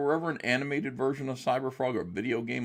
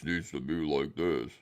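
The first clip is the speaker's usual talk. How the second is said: lightly put-on booming giant voice; a hint of a big, booming character; 5 semitones lower than usual. Shown as a demonstration. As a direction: briefly mimic a booming voice. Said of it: "like, a big booming voice".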